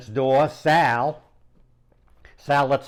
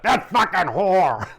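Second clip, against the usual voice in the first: mock mumbled curse; 5 semitones higher, 4 dB louder than usual. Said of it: "he's mumbling under his breath".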